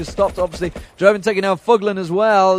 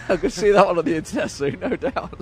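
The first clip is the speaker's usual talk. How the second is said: laughing. Real, not acted. Laughing about a yawning photo.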